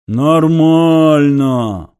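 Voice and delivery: slow loud howled word; howling beast voice. A narrator's reading. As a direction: howl it like a wolf. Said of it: "провыла достаточно разборчиво".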